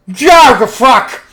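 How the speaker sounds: high, loud shocked shout; startled yell. Acted. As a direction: yelp it in alarm. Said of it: "Gluten goes".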